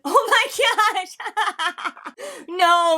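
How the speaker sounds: high, drawn-out "oh my gosh" into laughter; real laughing disbelief. Real, not acted. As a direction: crack up, scandalized and delighted. Cracks up at the diss.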